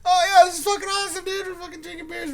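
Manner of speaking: high, hurried burst of delight; put-on high voice, thrilled with yourselves; mock excited teen. Sixteen-year-old drinking beers on the golf course, excited.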